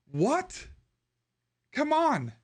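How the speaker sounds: high, incredulous disbelief; what, seriously, come on; exasperated. Real, not acted. Exasperated reaction to corporate news.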